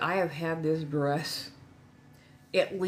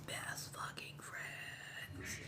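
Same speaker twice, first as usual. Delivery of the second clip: slow, drawn-out whisper; emphatic whisper; 18 dB quieter, much slower than usual. Real, not acted. Telling viewers about a former partner turned best friend, in an emphatic whisper. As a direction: whisper each word for emphasis.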